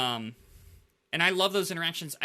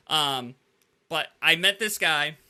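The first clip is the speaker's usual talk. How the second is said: ordinary delivery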